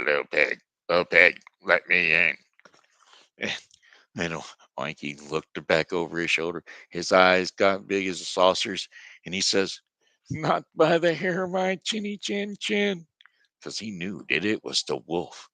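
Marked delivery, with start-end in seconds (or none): 0.0-2.4 loud, booming wolf demanding at the door; big bad wolf. 10.3-13.1 very high squeaky little pig voice; frightened little pig. Acted. Scared little pig refuses wolf.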